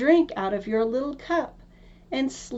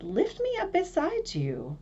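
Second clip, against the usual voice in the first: frog voice; 9 semitones higher, 3 dB quieter than usual. Acted. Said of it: "There he sat and cried".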